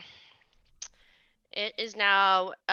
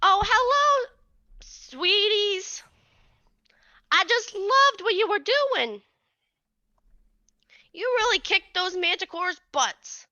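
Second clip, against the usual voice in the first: put-on high, sweet old midwife; sweet old lady; 9 semitones higher than usual. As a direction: lift into a doting old lady.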